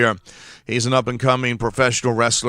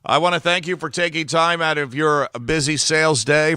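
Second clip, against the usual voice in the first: normal talking voice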